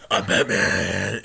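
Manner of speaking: Batman voice. Pretend. Vlogger doing the famous Batman line. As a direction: growl it like the Batman movie.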